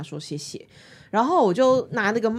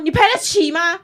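higher, louder sneering mock challenge; retold taunt; 8 semitones higher, 5 dB louder than usual. Stranger mocking whether she can pay for the helmet, sneering yell. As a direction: jeer it like a petty bully. Angry man taunting about payment.

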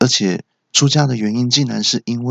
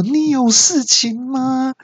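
very high, stretched mock screech; imitated indignant cry; 12 semitones higher than usual. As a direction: protest like the outraged nurse, up in head voice. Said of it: "那护士就大叫说". (学护士尖声抗议大叫)

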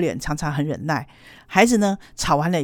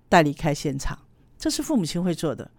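ordinary delivery

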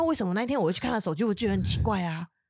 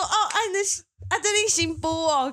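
high mock-cheerful relatives' voice; teasing relatives; 10 semitones higher than usual. Relatives teasing about the new bride, high and playful.

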